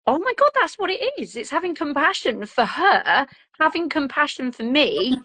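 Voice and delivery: high, quick burst of sudden insight; the penny drops, lifted and fast; excited realization. Retold or imitated. Mum recalling her breakthrough to the host, excited and quick. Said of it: "the peace I felt inside of me of like".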